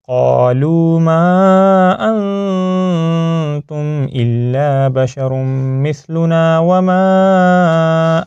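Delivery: slow, drawn-out Quranic recitation. Shown as a demonstration. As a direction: recite slowly with long held vowels. (ٹھہر ٹھہر کر تلاوت)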